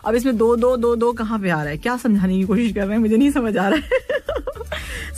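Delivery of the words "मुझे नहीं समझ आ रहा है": slow, higher, laughing admission. Real, not acted. Host laughing that she can't understand a listener. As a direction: laugh it out slowly, giving up.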